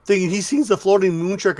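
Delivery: usual speaking voice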